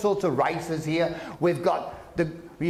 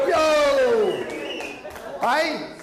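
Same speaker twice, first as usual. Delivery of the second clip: sudden shouted yo, higher; 6 semitones higher than usual. Acted. Calling out to the Italians.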